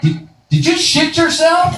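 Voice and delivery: put-on high, slow, disgusted teen boy; disgusted squeal. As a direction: squeal it in horror.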